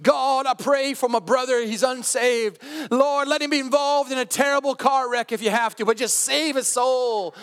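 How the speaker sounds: high, fervent preacher-voice prayer; T D Jakes. Retold or imitated. Buddy praying aloud for his unsaved brother, hammed-up preacher voice. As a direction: imitate a preacher crying out to God. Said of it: "he would pray in a preacher voice".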